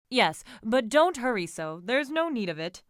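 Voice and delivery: mildly impatient call up front; calling orders. A narrator's reading. Franz telling the driver not to hurry, calling orders.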